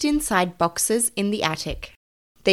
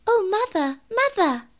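high, huffy kitten voice with falling tune; 13 semitones higher than usual. Acted. Mittens tipped from the jar to her mother, huffy. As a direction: call for mother in a high-pitched huff. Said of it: "said Mittens in a huff".

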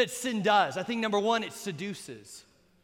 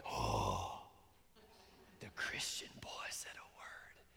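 hushed, scandalized gasping whisper; mock shocked whisper; 17 dB quieter than usual. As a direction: imitate friends whispering in disbelief. Imitates friends' scandalized reaction.

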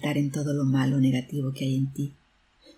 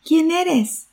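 high, louder questioning call; surprised and confused; 10 semitones higher, 6 dB louder than usual. A narrator's reading. Surprised boy asks the unknown voice, high confused call. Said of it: "El niño, sorprendido y confundido".

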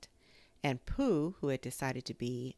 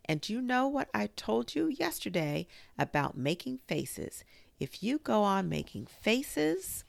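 high, emphatic motherly scolding; lilting stern warning; rather severe; 6 semitones higher, 4 dB louder than usual. A narrator's reading. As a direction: scold the child firmly but lightly. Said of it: "said Kanga rather severely".

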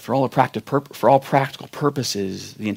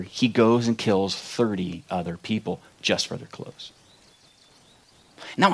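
ordinary delivery

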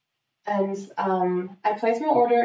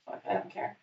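muttered aside; 9 dB quieter than usual. Real, not acted.

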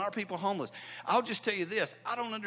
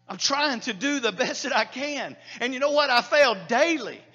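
louder, ringing pulpit preaching; preaching at full force; 7 semitones higher, 8 dB louder than usual. Acted. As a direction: hammer each "without any" home to the congregation.

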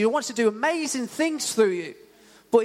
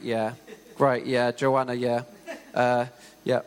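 put-on low, dull, lifeless droning to a girlfriend; a bored boyfriend going through the motions, voice held low; monotone; 6 semitones lower than usual. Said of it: "in a really monotone voice".

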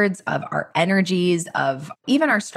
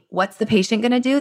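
normal talking voice

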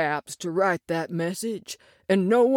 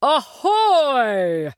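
hearty shout; 6 semitones higher, 5 dB louder than usual. Acted. Man answers from back of room.

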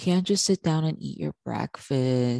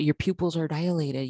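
usual speaking voice

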